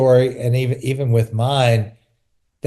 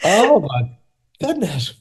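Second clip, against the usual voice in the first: amazed; 7 semitones higher than usual. Real, not acted. Moved listener reacts to story.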